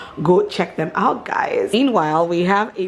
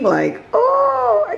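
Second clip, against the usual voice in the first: high swoon; much slower than usual. Real, not acted. Swooning over soft pots.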